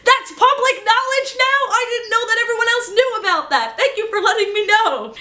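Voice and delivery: high, fast, panicked mock freak-out; imitating a startled voice actor, laughing. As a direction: squeal it out like the secret just leaked. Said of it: "he freaked out".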